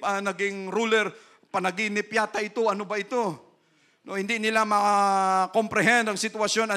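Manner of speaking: ordinary delivery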